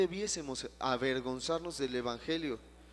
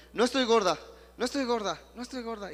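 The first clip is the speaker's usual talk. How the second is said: higher, louder, believing every word; said with conviction; 6 semitones higher, 7 dB louder, faster than usual. Retold or imitated. Pastor acting out a woman's conviction, higher and louder. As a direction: repeat it like you finally believe it. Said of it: "Repítelo creyéndolo".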